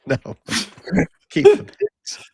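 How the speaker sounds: joking through real laughter; words breaking into laughter; laughing. Real, not acted. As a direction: crack up as you say it. Joking about wanting the bags back.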